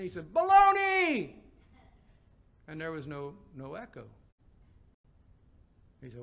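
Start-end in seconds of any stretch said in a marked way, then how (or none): 0.3-1.4 very loud, high, drawn-out yell; canyon yell. Retold or imitated. Preacher testing a canyon for an echo, full-voiced yell. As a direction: holler it out slowly across a canyon. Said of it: "he yelled out in the canyon".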